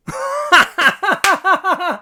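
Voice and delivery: very high burst of laughter; helpless high cackle; shrieking laugh. Real, not acted. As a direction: crack up hard. Maxime robs cashiers.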